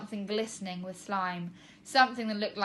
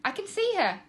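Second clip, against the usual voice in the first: excited cry; 11 semitones higher, 4 dB louder than usual. Acted. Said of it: "cried Tina".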